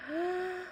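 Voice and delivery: quick high in-breath; sharp gasp. Shown as a demonstration. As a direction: gasp in air. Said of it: "Duck breathed in".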